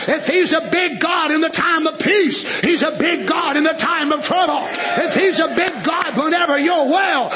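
shouted rhythmic preaching, building; preaching at full force. Real, not acted. Preacher rousing the church about a big God, shouted preaching. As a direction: call out each line like a revival refrain.

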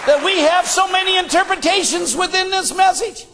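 impassioned pulpit delivery; full-force preaching. Real, not acted. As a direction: preach it hard, pitched well up.

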